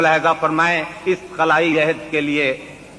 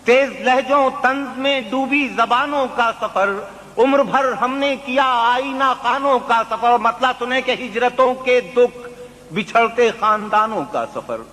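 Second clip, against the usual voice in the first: poetry recitation; 7 semitones higher than usual. Acted. Mushaira recitation.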